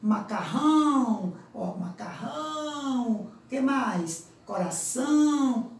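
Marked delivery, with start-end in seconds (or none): 0.0-1.3 "macarrão" with a loud, drawn-out nasal "ão"; som nasal destacado. Shown as a demonstration. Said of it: "Outra palavra". 1.8-3.1 "macarrão" slow, with a long nasal "ão"; som nasal destacado. Shown as a demonstration. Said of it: "Outra palavra". 4.4-5.7 "Coração" slow, nasal "ão" held long; som nasal destacado. Shown as a demonstration. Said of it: "a passagem pelas fossas nasais".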